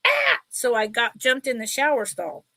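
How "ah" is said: short, very high mock shriek; high startled squeal, acted out; retold yelp. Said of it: "And I was like".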